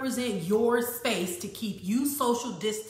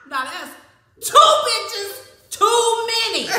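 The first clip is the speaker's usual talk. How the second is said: laughing outrage; 11 semitones higher, 8 dB louder, slower than usual. Real, not acted.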